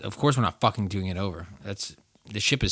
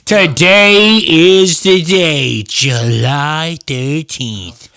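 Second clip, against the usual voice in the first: high, loud, slow overjoyed announcer; hammed-up intro; 9 semitones higher, 14 dB louder, slower than usual. Host opening the episode in a hammed-up, high, slow hype voice. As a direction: ham up the joy like a cheesy promo.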